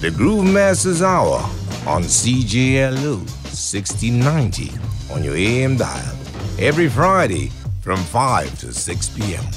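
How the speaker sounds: very deep radio announcer voice. Acted. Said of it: "Is that your real voice?".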